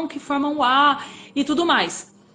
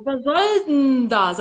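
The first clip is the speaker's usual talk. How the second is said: drawn-out, nasal "Was soll denn das?"; slow imitation of native German melody, nose and all; exaggerated nasal mimicry; much slower than usual. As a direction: stretch SOLL slowly, right through the nose. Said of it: "Até com o nariz".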